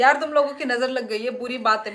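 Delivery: real laughing outburst; laughing complaint. Real, not acted. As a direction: blame the viewers while laughing. Blames viewers' evil eye, laughing.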